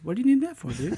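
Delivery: high, dreamy spaced-out voice; spaced-out earnest. Retold or imitated. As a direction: ask it earnestly, totally spaced out. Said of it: "so spaced out, but, like, earnest".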